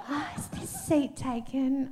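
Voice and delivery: put-on soft, slow, breathy girly ask; coy little-girl voice. Shown as a demonstration. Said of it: "I use my girl voice to get what I want".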